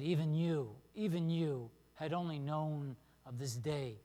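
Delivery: solemn public reading of the text; scripture reading. Acted. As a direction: read the passage to the congregation with weight. Reads the scripture passage.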